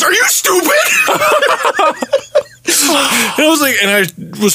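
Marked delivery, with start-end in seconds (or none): 0.0-0.7 high, laughing taunt; mocking yell at the attacker. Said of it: "and he goes". 0.7-2.4 mocking laugh. Attacker laughs at him.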